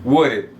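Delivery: "would it" with the d flapped like an r. Shown as a demonstration. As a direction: say "would it" like "wurit". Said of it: "pronunciar esse D aqui com som de R".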